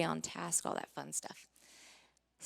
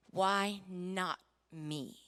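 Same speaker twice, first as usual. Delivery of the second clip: slow, deliberate question; much slower than usual. Shown as a demonstration. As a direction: lean on each word, slowly. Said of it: "I asked the right question".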